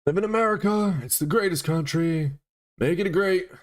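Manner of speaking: high, loud hammed-up patriot voice; put-on patriotic holler; mock patriot. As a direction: proclaim it high and loud like an over-proud American. Mocking patriotic American put-on.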